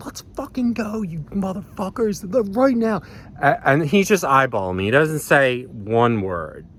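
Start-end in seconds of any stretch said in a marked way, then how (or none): 0.1-3.0 retold yelling. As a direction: act out yelling for a fight in a high voice. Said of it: "Like, I'm still yelling".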